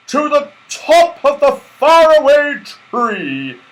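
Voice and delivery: high, loud, slow jolly command; Santa commanding. A narrator's reading. Santa ordering his sleigh to the treetop, booming command. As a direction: call the order out like a merry Santa. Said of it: "commanded Santa Claus".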